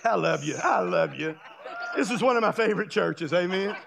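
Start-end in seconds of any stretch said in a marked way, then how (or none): 0.0-1.3 tender aside. Real, not acted.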